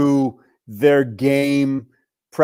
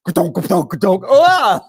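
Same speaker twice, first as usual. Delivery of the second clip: clunking thuds into a raised, louder cry; spoken crash sound effect; imitated bike crash; 3 semitones higher, 3 dB louder than usual. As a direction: mimic a bumpy crash ending in a yelp. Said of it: "the next thing I hear is".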